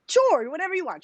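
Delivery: high-pitched, mock-eager yes; over-eager agreement. Vendors answering her bigger order, imitated over-eagerness. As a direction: imitate a seller who will agree to anything.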